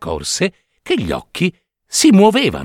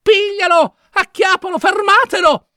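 desperate high-pitched street shouting; yelling. A narrator's reading. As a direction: shout for help catching the puppet. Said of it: "urlava Geppetto".

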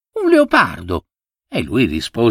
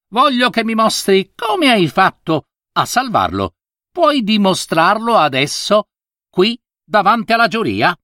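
put-on a higher, sharp-edged old monkey; harsh monkey voice; 6 semitones higher than usual. A narrator's reading. The monkey judge questions the monk, harsh and higher. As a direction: scold him sharply, like a stern judge. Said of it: "Con viso alterato e voce aspra".

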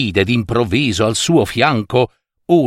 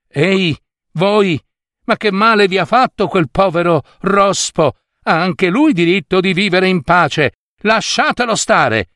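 high, raised voice calling out; scolding; 8 semitones higher than usual. A narrator's reading. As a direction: tell the bullies off. Youth scolds boys.

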